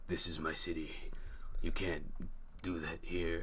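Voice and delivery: low, soft, halting villain voice; Fisk impression. Crime boss claiming his city, low hushed impression. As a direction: speak low and quiet, with heavy pauses. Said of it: "That's a pretty good impression".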